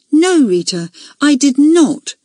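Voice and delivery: high, emphatic, ringing denial; loud and ringing. A narrator's reading. Accused girl answering head girl's question, loud ringing voice. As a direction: ring it out loud and clear. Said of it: "in a loud, ringing voice".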